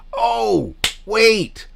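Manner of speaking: high, loud "hold on" outburst; sudden realization. Real, not acted. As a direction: jump in as if you just remembered. Sudden recollection mid-apology.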